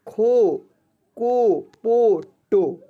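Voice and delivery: खो, को, पो, टो, loud with long held "oh"; syllable reading demo. Shown as a demonstration. Teacher showing pupils the o-matra, loud drawn-out syllables. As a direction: slowly call out खो, को, पो, टो. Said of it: "इसको पढ़ेंगे".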